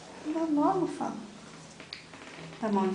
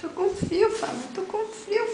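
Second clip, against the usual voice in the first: put-on baby voice; 10 semitones higher than usual. Mother voicing baby's words.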